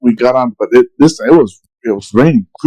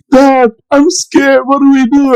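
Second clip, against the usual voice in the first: put-on scared kid; 13 semitones higher than usual. Son in the storm begging dad, high and scared. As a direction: plead like a terrified kid.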